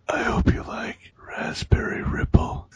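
sinister mock whisper; hammed-up menacing whisper; creepy whisper. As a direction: hiss it like a spurned creep. Doctor angry with melted cones.

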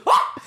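sudden loud yelp; mock scream cutting through the story; retold startled scream. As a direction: let out a short startled shriek. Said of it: "until she yelled".

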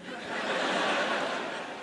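mocking titter. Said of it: "[name] hizo".

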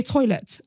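"toilet" with every T clearly sounded; crisp English T's in "toilet"; T's pronounced. Shown as a demonstration. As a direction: enunciate both T's in "toilet". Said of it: "I pronounce in all my T's".

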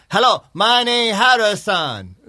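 an imitated Chinese classmate introducing himself; mock Chinese accent. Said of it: "Y se paró un chino".

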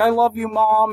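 high, lightly acted tearful cry; mock sobbing. Daughter to her dying mother, mock sobbing. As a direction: imitate a weepy daughter, pitched high. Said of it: "she's crying and she's sobbing".

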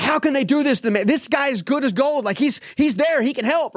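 high, strained frustrated inner voice; mad younger self. Retold or imitated. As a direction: voice your own outrage way up high. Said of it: "I'm mad, right? I'm mad".

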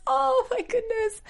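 high, drawn-out mock swoon; gushing reaction. Co-host retelling her reaction to the emotional vow, gushing. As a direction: let it soar high and slow, overcome.

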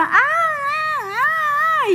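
high, hammed-up whimpering "ah"s; mock whining moans. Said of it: "Mi marido comienza".

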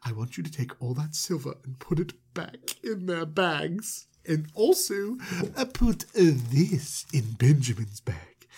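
lightly disguised, secretive instructions; slightly affected conspirator voice; furtive put-on voice. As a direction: give sneaky orders in an odd voice. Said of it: "Why are we putting on that voice?".

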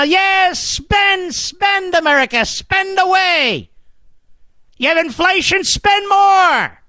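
mock spender cheer. Mocking Democrats' spending cry.

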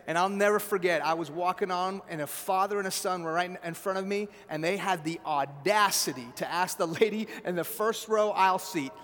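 ordinary delivery

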